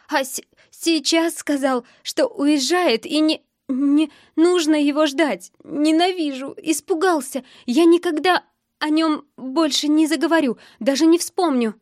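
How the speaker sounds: high, halting, tearful outburst; fighting hysterics. A narrator's reading. Girl fighting hysterics over the boy leaving, tearful. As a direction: stumble on the first words, then rise into upset vows. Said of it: "пытаясь справиться с истерикой".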